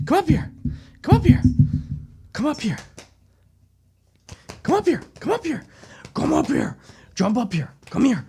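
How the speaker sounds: high sing-song pet coaxing; pleading with the dog to jump up, high and soft. Real, not acted. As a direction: coax the dog up onto the couch.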